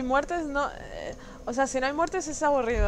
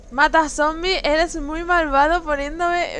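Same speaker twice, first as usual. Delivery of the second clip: drawn-out playful scolding, louder; mock scolding; 4 semitones higher, 6 dB louder, slower than usual. Real, not acted. Streamer scolds a chat troll, mock reproach. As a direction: call the viewer wicked in mock reproach.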